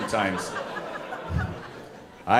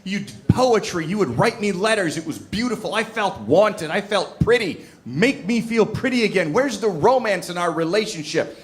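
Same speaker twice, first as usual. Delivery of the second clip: higher-pitched mock angry complaint; acted angry partner; 6 semitones higher than usual. Partner complains about lost romance, acted angry. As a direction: imitate an upset partner pleading and scolding. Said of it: "she got angry at me".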